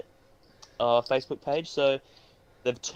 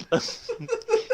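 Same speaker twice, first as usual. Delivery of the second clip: high laugh. Real, not acted. Recalls his easy job.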